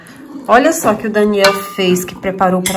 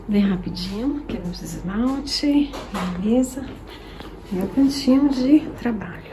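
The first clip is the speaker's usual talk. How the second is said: speaking softly; 10 dB quieter than usual. Real, not acted. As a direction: keep it down near clients. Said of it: "Tô falando baixo porque tem cliente".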